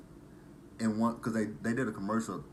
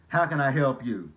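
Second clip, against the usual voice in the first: loud, raised drive-thru greeting with a rude edge; loud drive-thru worker; 5 semitones higher, 9 dB louder than usual. Retold or imitated. Imitating the drive-thru girl at Church's, loud and rude. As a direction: bark the order question like a bored cashier. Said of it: "when you go to the drive-thru".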